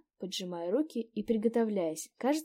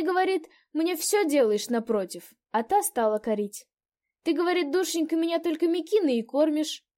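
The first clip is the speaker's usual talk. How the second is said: put-on old landlady; 6 semitones higher, 7 dB louder than usual. A narrator's reading. Landlady telling the quarrel.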